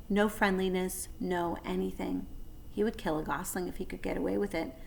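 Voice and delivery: ordinary delivery, nothing marked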